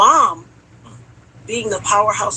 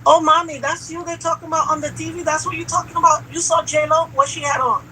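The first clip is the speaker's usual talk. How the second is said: higher, chatty nurse gushing over gossip; 8 semitones higher than usual. Retold or imitated. Nurse asks a shot patient about J Lo, higher chatty voice. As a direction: play the nosy nurse, light and high. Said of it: "And she says".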